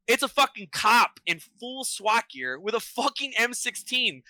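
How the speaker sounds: usual speaking voice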